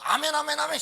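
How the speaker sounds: loud, insistent chorus of amens; noisy rapid amens. Acted. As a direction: fire off amens noisily. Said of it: "시끄러울 정도로".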